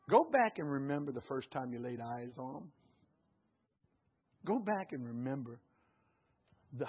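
low, soft, gentle encouragement; tender reminiscing. Acted. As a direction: speak it softly to married couples.

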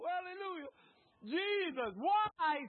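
usual speaking voice

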